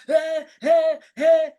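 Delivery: high, loud shouting back; mock angry shout in a quarrel. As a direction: yell back as if crying for help. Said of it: "if they raise your voice".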